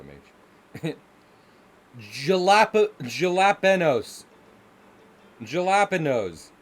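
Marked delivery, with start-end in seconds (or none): 2.0-6.5 badly anglicised American "jalapenos", hard J. Streamer mocking Midwestern pronunciation, hammed-up American accent. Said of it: "this is the American stereotype right here".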